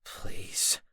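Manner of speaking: pleading whisper; hoarse whisper. A narrator's reading. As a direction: beg in a broken whisper. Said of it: "I said hoarsely".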